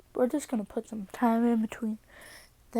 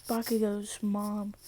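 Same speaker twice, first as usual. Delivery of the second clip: usual speaking voice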